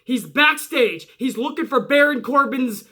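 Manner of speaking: usual speaking voice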